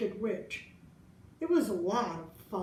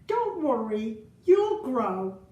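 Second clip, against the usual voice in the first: louder, slow-starting imitation of a reassuring grown-up; well-meaning adult reassurance; 3 semitones higher, 6 dB louder, slower than usual. Well-meaning adults to a small child, lightly imitated reassurance. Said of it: "very well-meaning adults told me".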